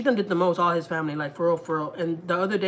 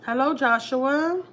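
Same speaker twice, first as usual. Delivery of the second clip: sarcastic hello; 8 semitones higher, 3 dB louder, much slower than usual. Real, not acted. Sarcastic aside after rant.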